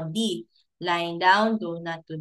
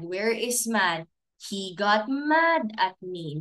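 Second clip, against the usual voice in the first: ordinary delivery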